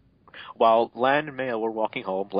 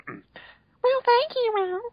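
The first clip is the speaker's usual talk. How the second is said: squeaky high falsetto; silly falsetto voice; much slower than usual. Acted. Narrator voicing the character in a squeaky falsetto, joking it's his male voice. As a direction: pitch way up for comic effect. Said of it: "let me get my male voice".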